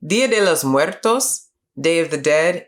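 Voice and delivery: usual speaking voice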